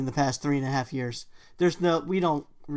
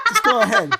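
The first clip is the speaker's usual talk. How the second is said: high, loud, laughing mimicry; teasing her with a high, loud laughing mimic; laughing mock quote; 9 dB louder than usual. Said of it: "You told me to go ahead, then you said".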